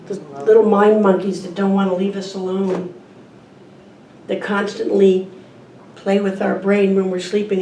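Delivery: usual speaking voice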